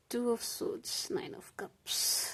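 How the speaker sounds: slow breathy whisper of the cards; whispered card names. Real, not acted. Tarot reader reading drawn cards aloud to herself, whispering. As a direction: murmur the card names to yourself.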